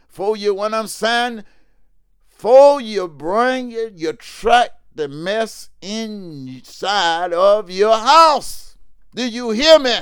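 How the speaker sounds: loud, high preacher's cry; full-force sermon shout; fiery preaching. Real, not acted. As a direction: drive it home to the congregation.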